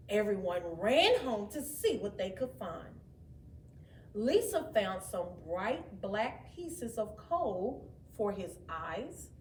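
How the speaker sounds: plain reading voice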